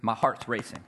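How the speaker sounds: ordinary delivery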